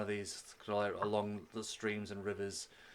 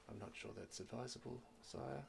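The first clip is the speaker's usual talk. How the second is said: soft, hesitant objection to a prince; deferential guard; 2 semitones higher, 13 dB quieter than usual. Acted. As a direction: tread carefully as a humble servant. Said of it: "He calls one of the guards".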